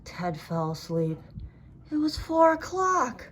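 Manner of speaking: ordinary delivery, nothing marked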